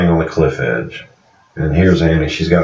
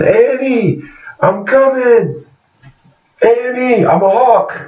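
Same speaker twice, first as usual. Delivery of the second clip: high, hammed-up screaming call; mock scream; slower than usual. Man flying to the moon calls to Annie, high mock scream. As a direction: shriek it like a giddy cartoon hero. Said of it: "And he's screaming".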